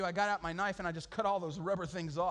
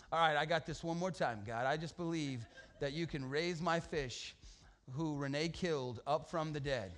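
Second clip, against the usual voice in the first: mock prayer; 4 semitones lower than usual.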